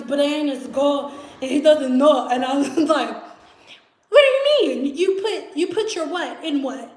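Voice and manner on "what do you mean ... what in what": high, incredulous questioning; mock disbelief. Storyteller questions roommate about the spaghetti, acted baffled disbelief. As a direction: start high and startled, then come down baffled. Acting out her shocked reaction.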